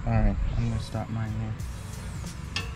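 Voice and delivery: usual speaking voice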